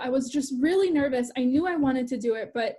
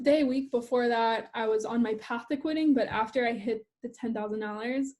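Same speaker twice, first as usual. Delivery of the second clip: ordinary delivery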